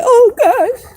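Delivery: high, loud mock whine; hammed-up distressed wail, pitched high. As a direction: ham up a dramatic despairing cry. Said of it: "all that hooting and hollering and crying".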